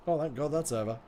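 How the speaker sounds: ordinary talk, nothing marked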